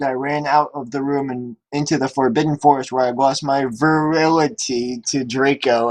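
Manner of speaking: normal talking voice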